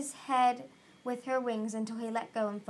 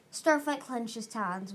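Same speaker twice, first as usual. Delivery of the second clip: plain reading voice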